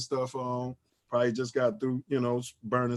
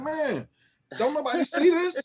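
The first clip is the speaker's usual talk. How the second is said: much higher, pleading, exasperated; exasperated plea; 11 semitones higher than usual. Retold or imitated. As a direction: beg the room to see it. Retold exasperation at his friends.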